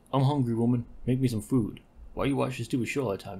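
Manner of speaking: loud, bossy complaint; gruff demanding husband. Acted. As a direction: bark for food at the wife. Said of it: "He's like".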